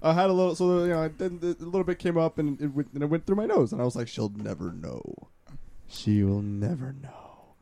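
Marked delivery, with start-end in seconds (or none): 4.1-7.5 slow, low, soft scheming murmur; a low, slow secretive self-assurance; sly inner voice. Retold or imitated. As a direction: reassure yourself slyly under your breath.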